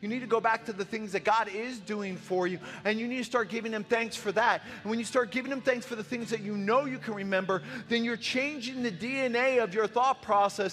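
fiery preaching. Real, not acted. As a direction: preach it hard to the room. Preaching at full force.

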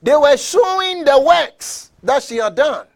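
loud, high, drawn-out preaching; preaching at full force. Real, not acted. Preacher to congregation about a woman's works, full-force preaching.

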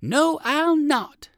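high, loud, slow refusal; defiant answer. A narrator's reading. Poodle doggy refuses the dizzy foxes, defiant answer. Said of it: "answered Fido Flip Flop".

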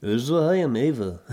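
put-on a rough go at a Russian accent; female Russian accent. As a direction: imitate a Russian woman introducing herself. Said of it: "can't do a female Russian accent".

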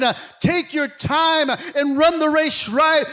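high, loud pulpit call; full-force preaching. Acted. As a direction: preach it out to the congregation.

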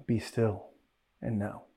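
quiet, gentle "Be still and know" said hushed; soft be still. Shown as a demonstration. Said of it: "we think he says".

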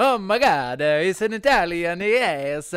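high, loud bad Italian accent; a deliberately bad Italian accent. Pitching a bad Italian accents segment, bad Italian accent. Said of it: "Eitan and Naor do bad accents".

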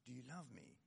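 the same question dropped almost to a hush. Retold or imitated. Jesus asking Peter a second time, hushed. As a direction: let it fade to near silence. Jesus asks again, softer.

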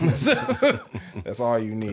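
slow, laughing through it. Real, not acted. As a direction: laugh the words out slowly.

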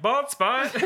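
teasing friend calls out a bald spot; mock taunt. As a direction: bark out the insult playfully. Mocking friend's livestream comment.

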